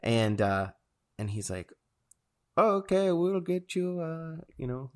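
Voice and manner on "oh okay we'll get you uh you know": put-on high-pitched Indian accent. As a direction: imitate an Indian order taker. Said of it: "is that your Indian accent".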